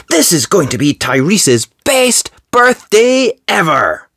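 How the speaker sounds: high, eager cheer building to "ever"; excited pup. Acted. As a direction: gush with birthday excitement, pitch climbing. Pup's excited line in story.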